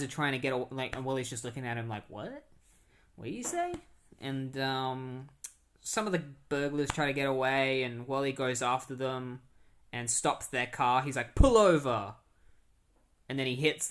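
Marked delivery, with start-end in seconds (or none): 2.1-3.7 high, rising puzzled questions; mock confusion, pitch jumping up at the end; acted bafflement. Wally baffled by chef's words. 11.3-12.1 loud call-out, falling in pitch; play-acted shouted order; mock command. Flash stopping the robbers' car.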